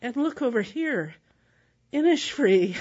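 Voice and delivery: tour guide announcing. Retold or imitated. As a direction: announce it to the whole boat, swelling on Innisfree. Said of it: "the tour operator says".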